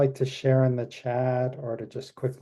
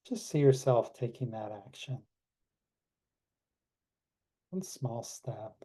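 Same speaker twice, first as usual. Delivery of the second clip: guided meditation. Acted. Leads closing meditation.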